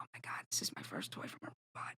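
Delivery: breathy mock whisper of wonder; imitating awed child. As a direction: whisper it like an awestruck little kid. Excited child's awestruck reaction, retold.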